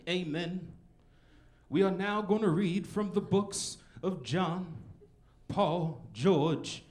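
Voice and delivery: a lightly done pulpit reading; pastor preaching. Retold or imitated. Pastor reading scripture to the congregation, slow preacher voice. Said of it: "this was actually what the pastor said".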